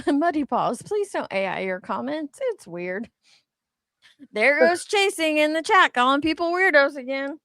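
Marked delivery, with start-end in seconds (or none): none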